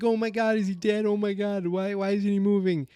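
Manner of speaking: high-pitched mock freak-out; Argyle. Friend seeing the agent die, mock panic, high. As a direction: imitate panicking, voice held way up. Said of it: "Argyle's freaking out".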